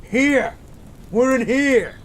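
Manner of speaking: high, loud call across the distance; shouting for rescuers. Acted. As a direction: yell so the rescue team can find you. Calling out to rescuers.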